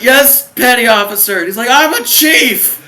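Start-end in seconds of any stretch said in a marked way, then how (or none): 0.0-1.4 high, loud boot-camp acknowledgement; hammed-up frantic obedient shout; shouted recruit reply. 1.7-2.8 high, loud, slow outraged bellow; drawn-out furious mock shout; imitated chief's yell. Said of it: "you wanna see a chief yell?".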